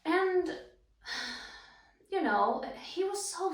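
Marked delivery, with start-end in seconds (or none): 1.1-1.6 quiet reflective sigh; soft sigh. Acted. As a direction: let out a soft breath. Reflecting on partner's success.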